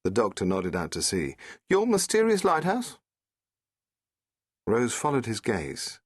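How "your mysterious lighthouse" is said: put-on higher, lively young woman; Rose's voice. Rose asking the Doctor about the sea, a young woman's voice.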